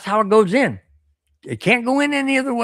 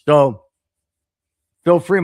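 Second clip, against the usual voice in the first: ordinary talk, nothing marked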